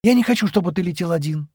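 ordinary delivery, nothing marked

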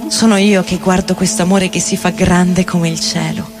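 ordinary talk, nothing marked

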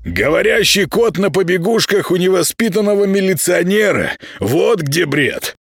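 high mocking creature retort; mocking high house spirit. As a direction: throw the phrase back, squeaky and snide. Spirit mocks the same phrase back.

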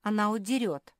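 high, loud pouting accusation; sulky. A narrator's reading. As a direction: blurt it in a huff. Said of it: "надулась Наретта".

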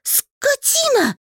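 very high, slow drawn-out insult; furious bark. A narrator's reading. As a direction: yell the insult. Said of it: "рявкнула я". (рявкнуть очень высоко и протяжно)

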